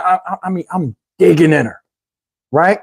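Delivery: usual speaking voice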